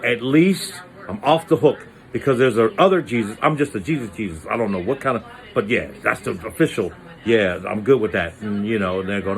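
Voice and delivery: normal talking voice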